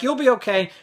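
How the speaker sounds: high imitated mother voice; dismissive mom. Mom walking off from son, high dismissive voice. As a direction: brush it off as a mom.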